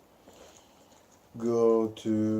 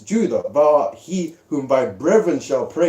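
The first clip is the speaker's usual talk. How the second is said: slow, louder, solemn recitation; scripture reading; 3 semitones higher, 4 dB louder than usual. Acted. Reading Genesis about Judah to the group, solemn slow recitation. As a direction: proclaim the verse slowly like a preacher. Rereads first verse.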